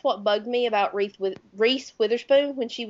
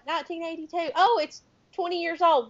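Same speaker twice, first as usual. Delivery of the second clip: higher-pitched puzzled working-out; retold realization; 7 semitones higher than usual. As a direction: act out doing the math in surprise. Acting out her own dawning realization.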